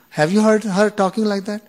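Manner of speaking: ordinary talk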